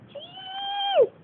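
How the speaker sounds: very high, thin singsong call; high-pitched call. Real, not acted. Calling Cheese in a high voice.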